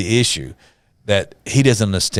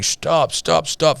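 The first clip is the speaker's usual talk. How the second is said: high-pitched retold pleading, without real sobs; crying plea; 9 semitones higher, much slower than usual. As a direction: imitate a desperate cry to halt it, pitched well up. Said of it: "His wife's crying, saying".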